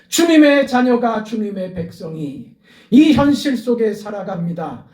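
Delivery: loud, high, pleading cry to the Lord; a preacher voicing Bartimaeus's cry for mercy; impassioned preaching. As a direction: plead with the Lord at full force.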